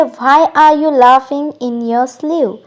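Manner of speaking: slow, clear English model line; slow model sentence. Shown as a demonstration. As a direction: spell it out gently for learners. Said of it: "English में कहेंगे". (धीरे और साफ़ अंग्रेज़ी वाक्य)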